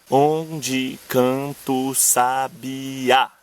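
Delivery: very slow, syllables drawn apart; scanned verse. Shown as a demonstration. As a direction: tap out each poetic syllable. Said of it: "Nesse caso, a gente conta até o A final".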